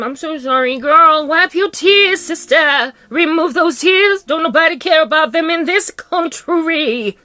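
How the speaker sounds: high, loud theatrical consoling; mock sympathy. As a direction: fuss over the victim with exaggerated pity.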